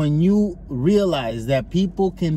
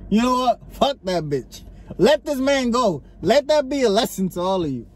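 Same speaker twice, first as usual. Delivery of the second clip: acted defiant verdict; 6 semitones higher than usual. Voices a sympathetic juror's verdict.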